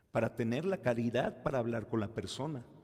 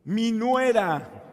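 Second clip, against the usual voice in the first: higher, louder, slowly stretched grievance; indignant mother-in-law; 8 semitones higher, 9 dB louder, much slower than usual. Retold or imitated. Mother-in-law opening her confession about her daughter-in-law, indignant and slow. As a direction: spit out the name of the enemy. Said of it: "¿Qué creen que me dijo?".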